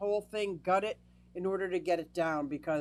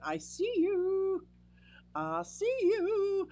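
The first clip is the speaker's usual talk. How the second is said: much higher, slow, playful call-out; high lilting peekaboo greeting; teasing sing-song; 11 semitones higher, slower than usual. Real, not acted. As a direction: tease a viewer you just spotted. Greeting a chat viewer.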